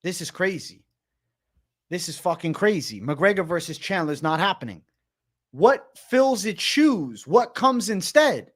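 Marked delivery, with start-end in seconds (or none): 5.5-8.5 slow, drawn-out questions; slow pondering questions to chat; dramatic questions. Real, not acted. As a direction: stretch each word out for drama.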